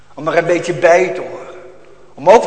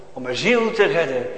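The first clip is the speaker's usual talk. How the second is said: normal talking voice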